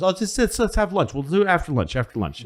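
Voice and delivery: high, airy brush-off voice; breezy FDR. Retold or imitated. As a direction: wave him off lightly toward lunch. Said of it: "F D R goes".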